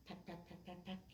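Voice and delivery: put-on soft pecking sounds in a poem for kids. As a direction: tap out little bird pecks softly.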